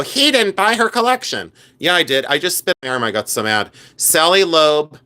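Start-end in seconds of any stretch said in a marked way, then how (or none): 0.0-1.5 higher-pitched mock of doubters; mocking naysayers. Seller imitating collectors who doubt her, mocking and high. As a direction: mimic the doubting crowd, pitched up. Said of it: "and then people go".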